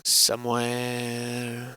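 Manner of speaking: slow, drawn-out mysterious echo; mock mysterious. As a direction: stretch the word like a spooky narrator.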